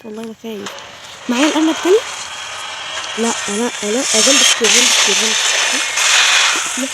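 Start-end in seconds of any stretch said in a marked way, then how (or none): 1.3-6.9 loud, frantic yelling at the jump scare; real startled screaming, the last words dragged out slowly; panicked shouting. Real, not acted. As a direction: yell it out in a fright.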